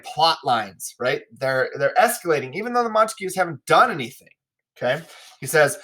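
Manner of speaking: normal talking voice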